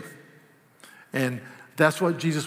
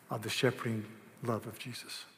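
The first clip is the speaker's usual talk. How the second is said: ordinary talk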